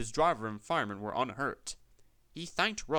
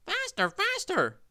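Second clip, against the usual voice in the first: high, loud grumbling truck voices; 5 dB louder than usual. A narrator's reading. Trucks urging each other downhill, grumbling loudly. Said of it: "they grumbled".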